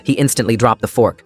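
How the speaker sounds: plain reading voice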